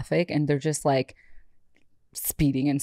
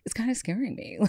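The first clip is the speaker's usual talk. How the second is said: much softer, higher aside; retold uneasy muttering; 7 semitones higher, 8 dB quieter than usual. Driver privately noting the man behind her, soft uneasy aside. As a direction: say it small and wary, as if to yourself. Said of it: "I was just like".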